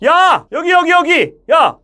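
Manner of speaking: loud, high call to someone distant; urgent, projected hail; calling far away. Shown as a demonstration. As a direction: holler across a distance, sending the voice forward. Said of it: "멀리 있는 사람 부를 땐".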